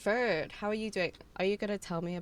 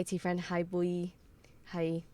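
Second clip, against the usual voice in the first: ordinary talk, nothing marked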